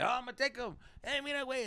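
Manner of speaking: lightly put-on father, pitched well up; imitating his dad. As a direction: act out Dad's eager offer. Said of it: "and you say, like".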